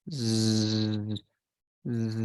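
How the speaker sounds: long, low, steady buzzing "zzz"; Z sound. Shown as a demonstration. Teacher showing a student the Z sound, sustained voiced buzz. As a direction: hold a droning voiced "zzz" like a shaver. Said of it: "el sonido de la ... Z".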